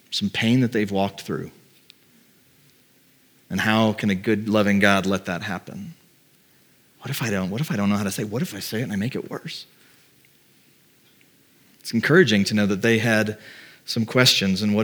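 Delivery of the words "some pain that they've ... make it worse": low, hushed worry trailing away; quiet self-doubt. Acted. Preacher voicing a believer afraid of making someone's pain worse, hushed and hesitant. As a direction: voice an inner doubt very softly. Voicing fear of saying the wrong thing.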